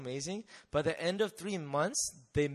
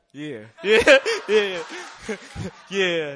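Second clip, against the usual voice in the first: mock cool yeah; 4 semitones higher, 14 dB louder, slower than usual. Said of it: "But outside, I was like".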